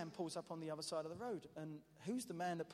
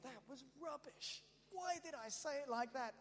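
higher mock despair at your own words; self-mocking regret; 10 semitones higher than usual. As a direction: scold yourself after a missed chance. Mocking his own inner regret.

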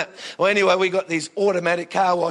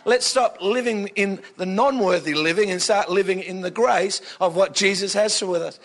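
ordinary talk, nothing marked

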